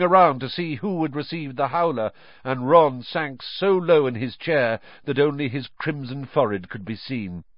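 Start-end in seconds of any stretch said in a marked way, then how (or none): none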